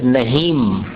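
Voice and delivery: slow नहीम closing on a clear m; नहीम slowly with the m sounded; full m ending. Shown as a demonstration. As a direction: stretch नहीम and land on the m. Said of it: "अनुस्वार का जो उच्चारण होता है, जैसे".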